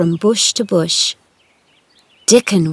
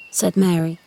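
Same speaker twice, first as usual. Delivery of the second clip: usual speaking voice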